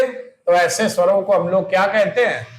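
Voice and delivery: ordinary talk, nothing marked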